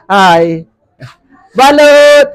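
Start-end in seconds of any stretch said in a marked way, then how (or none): 1.6-2.3 hawker's shout across the street; vendor's call. Real, not acted. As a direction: call out to buyers. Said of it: "sa pagsigaw ko".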